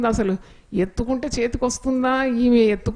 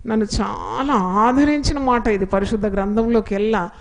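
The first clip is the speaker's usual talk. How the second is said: ordinary talk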